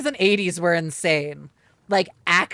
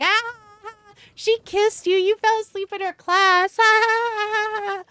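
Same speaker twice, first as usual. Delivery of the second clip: mock kids voice; 13 semitones higher, 3 dB louder, slower than usual. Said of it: "everyone was just like".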